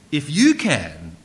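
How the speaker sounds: loud, slow stress on "If you can"; with emphasis. Acted. Jesus recites the father's words back, emphatic and loud. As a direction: throw "If you can" back loudly, drawing out "you can". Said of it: "with emphasis".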